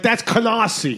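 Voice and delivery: put-on Brooklyn accent. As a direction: correct them in a Brooklyn accent. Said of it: "they're like".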